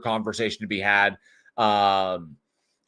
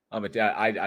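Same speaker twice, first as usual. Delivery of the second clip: ordinary talk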